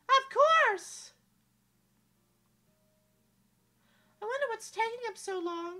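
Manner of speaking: put-on high, bright young princess, wistful; a lovely princess doubting fairy tales; princess Amelia voice.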